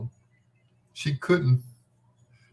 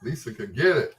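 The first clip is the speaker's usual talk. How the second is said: normal talking voice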